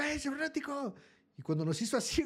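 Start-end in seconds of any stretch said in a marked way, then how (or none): 0.0-1.0 calling out. Retold or imitated. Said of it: "y un morro le gritó".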